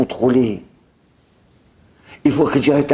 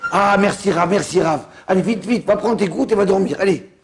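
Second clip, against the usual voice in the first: acted urging; 7 semitones higher than usual. Acted urging to take drops.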